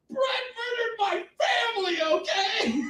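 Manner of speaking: high-pitched, hammed-up tearful cry; mock wailing. Crier improvising an excuse to a shopper, mock tearful wail. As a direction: wail it out as a fake sob story. Fakes grief to excuse crying.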